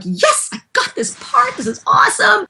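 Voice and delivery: giddy victory voice, way up high; gleeful. Retold or imitated. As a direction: squeal like you just won the part. Her glee at getting the part.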